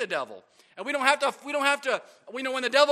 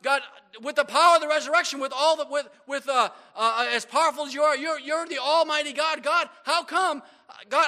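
higher, louder, earnest plea to God; pleading prayer; 4 semitones higher, 3 dB louder than usual. Retold or imitated. Believer pleading with God in prayer, earnest cry. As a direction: beg God for victory, voice lifted. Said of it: "and ask God".

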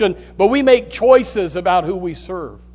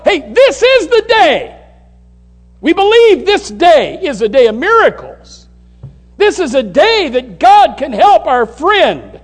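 shouted faith; 9 semitones higher, 6 dB louder than usual. Acted. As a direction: proclaim it as friends sure of a miracle. Acts the friends' bold faith.

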